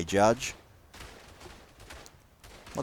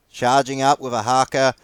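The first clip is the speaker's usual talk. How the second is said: normal talking voice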